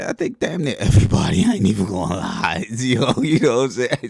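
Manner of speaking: loud, laughing candid aside. Real, not acted. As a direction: laugh while admitting it. Joking admission, breaks into laughter.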